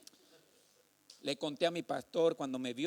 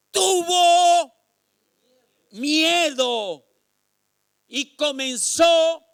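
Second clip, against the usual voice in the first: loud, high, slow sermon shouting; drawn-out preacher's cries; fiery preaching; 9 semitones higher, 17 dB louder, much slower than usual. Acted. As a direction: preach it at full force to the church. Preaching on Peter.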